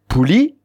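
"Pouli" lifting high onto "li"; stress on last syllable. Shown as a demonstration. Teacher giving the word for joli, stress on "li" in "Pouli". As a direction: push up onto the final "li" of "Pouli". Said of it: "on a l'accent tonique sur la dernière syllabe".

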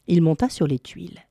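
plain reading voice

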